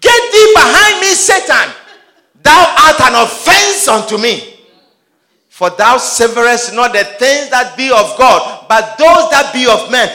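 high, loud, sung-out rebuke; preached rebuke. Acted. Jesus rebuking Peter as Satan, loud high preaching. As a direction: thunder it like scripture from the pulpit.

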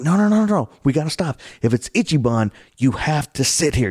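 high, loud urgent warning; urgent pleading to stop and sit; frantic scout hushing the group; panicked older scouts. Retold or imitated. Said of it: "the older scouts are like".

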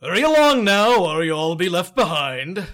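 loud, high, hearty call; booming giant voice. Acted. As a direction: urge them on heartily. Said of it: "The spirit speaks to Scrooge".

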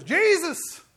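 loud high cry of Jesus; a drunk man's sudden shout. Retold or imitated. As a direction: blurt the name out loud. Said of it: "he screams it out a third time".

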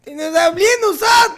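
high, loud comic protest; mock outraged shout. Latinos complaining they were forgotten, mock outraged shout. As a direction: cry out as if left out. Acting out Latinos feeling left out.

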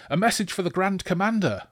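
high-pitched urgent call; yelled announcement. Acted. Rider bluffing with a message for the commander, yelling. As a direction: cry it out to the whole camp. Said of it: "Deverk yelled".

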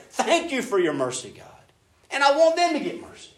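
high, loud, laughing wonder at grace; grateful believer praying in amazement; amazed gratitude. Retold or imitated. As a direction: marvel at your own salvation. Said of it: "this kind of shock and awe".

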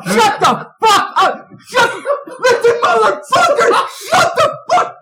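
very high, loud screamed outburst; descending into rage. Retold or imitated. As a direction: yell furiously down the phone as an exasperated dad. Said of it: "this is how it descends".

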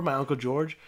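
ordinary delivery

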